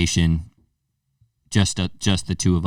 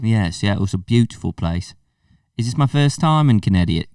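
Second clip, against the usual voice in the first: mock British tourist; put-on Liverpool accent. Visitor on his first time in town, Liverpool accent. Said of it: "We could talk like this".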